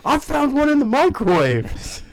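squeaky high punchline; high joking voice. Pretend. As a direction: jump way up high. Joke about eggs.

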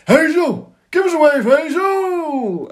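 high, loud drawn-out shout; imitating a crowd heckler going mad; overexcited heckle. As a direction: yell like a fan desperate to be noticed. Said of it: "going mad, getting excited".

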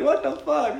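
high-pitched baffled protest; mock startled. Grandson answers the prankster ghost, mock startled. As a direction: squeak out disbelief, half joking.

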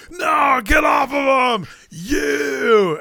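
high-pitched drawn-out wrestling shout; mock frantic yell. Cohost re-enacts the moment after the power bomb, mock yelling. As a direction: cry out in hammed-up alarm. Acting out the comeback after cover.

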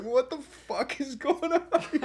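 very high, slow incredulous question; hammed-up baffled disbelief; mock bewilderment. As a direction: squeal it slowly in total confusion. Bewildered inner reaction, retold.

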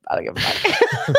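laughing through it, high; laughing defiance. Real, not acted. Cohost laughing off his own insult, giggling. Laughing after rude remark.

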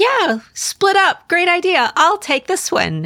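high, slow sarcastic drawl; mock the character's line up high; mock sarcastic quote.

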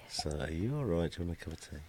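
very soft, low murmur; barely audible thinking aloud; muttering to oneself. Real, not acted. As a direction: work it out under your breath. Mutters the decoded sentence, very faint.

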